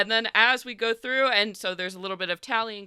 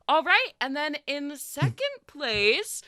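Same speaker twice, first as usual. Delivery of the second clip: high, bright-eyed announcer voice, much higher; chirpy festival host calling the crowd in; sugary village host, high and beaming; Lila the host; 10 semitones higher, slower than usual. Acted. Lila announces second place.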